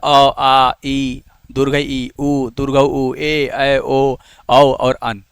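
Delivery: slow, spaced-out Hindi vowel list; vowel-sound demonstration. Shown as a demonstration. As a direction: recite each vowel separately, drawn out. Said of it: "जैसे vowel क्या होता है".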